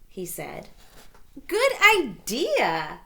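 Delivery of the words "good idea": high, slow and louder; high cheerful girl voice; little girl cheer. A narrator's reading. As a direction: chirp it happily. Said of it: "said Little Red".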